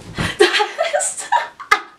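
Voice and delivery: high breathy burst of laughing; cracking up at the memory; real laughter. Real, not acted. Laughing at friend's walk.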